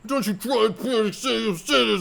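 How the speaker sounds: high, loud menacing shout; mock threat. Rod threatening the girl, mock menacing shout. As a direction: bark a threat at her. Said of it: "Rod full-on threatens her".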